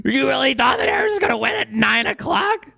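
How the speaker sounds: high, loud, whiny taunting cry; a hammed-up mocking wail; mocking whine. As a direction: whine it like a gloating troll. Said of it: "they're like".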